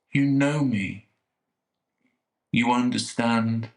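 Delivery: normal talking voice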